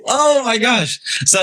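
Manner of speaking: bursts out laughing when the thought comes back. Real, not acted. Suddenly remembers his point.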